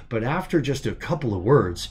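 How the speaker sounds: ordinary delivery, nothing marked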